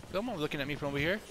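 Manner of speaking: normal talking voice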